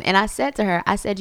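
normal talking voice

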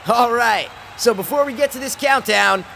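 loud, high, hyped-up broadcast announcer; host the Times Square countdown over the crowd; big cheesy live-TV presenter shout; New Year's TV host. Acted. Mock New Year's broadcast hosts.

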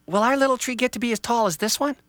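put-on an eager child pointing out a tree; lightly voiced child. Child tells Dad about the tree, lightly voiced kid.

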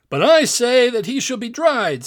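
loud, high-pitched giant's objection; insistent arguing. A narrator's reading. Giant arguing with his friend over supper, insistent.

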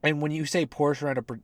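ordinary talk, nothing marked

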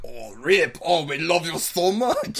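put-on lightly French-accented gushing; French manager. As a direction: gush over him in a light mock French accent. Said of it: "the French manager was like".

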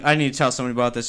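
very fast, urgent mutter; rushed urgent. Retold or imitated. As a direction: blurt it out in a rush. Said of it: "I was like".

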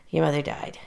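father telling his daughter her mother died, soft and sad; sad face. Retold or imitated. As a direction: break the news gently and quietly. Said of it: "gets that little sad face on".

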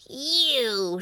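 high, drawn-out witch holler; angry witch's call; Baba Yaga. A narrator's reading. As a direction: holler it as an accusation. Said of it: "she hollered".

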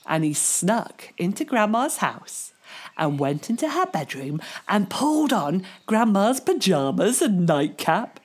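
ordinary delivery, nothing marked

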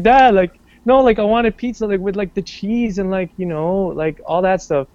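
high, whining protest to dad; whiny kid complaint. Retold or imitated. Kid complaining to his dad about fake pizza, high whiny protest. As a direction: plead like a let-down child. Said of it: "I'm like".

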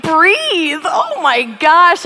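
high, laughing gasp of shock; shocked laughing disbelief. Retold or imitated. Caught eating pizza by her watch, laughing in shock. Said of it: "I was like".

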